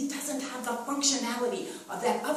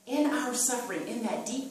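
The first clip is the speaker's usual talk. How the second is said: ordinary delivery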